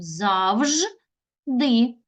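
"Завжди" as Завж, a pause, then ди; teacher splitting "Завжди" into Завж... ди; another word split. Shown as a demonstration. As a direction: break "Завжди" just before the last syllable ди. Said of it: "можемо поділити його іншим способом".

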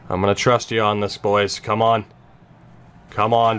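ordinary delivery

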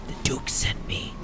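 slow, soft conspiratorial whisper; mock whisper. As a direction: whisper it slowly like a secret. Confiding in the game pig.

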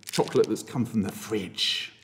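a hint of a bully's threat; mock menacing offer. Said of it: "I could also say".

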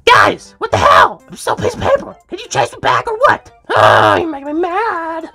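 loud, high-pitched complaint; angry outburst. Acted. Toad still paper while others celebrate, angry outburst. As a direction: rant at being forgotten.

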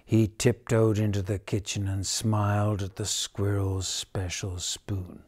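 usual speaking voice

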